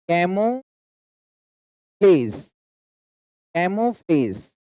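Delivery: "कैमोफ्लेज" drawn out with a pause before "फ्लेज"; broken into chunks. Shown as a demonstration. Teacher models a new word for learners, slow and split. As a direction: say "कैमोफ्लेज" slowly in two parts.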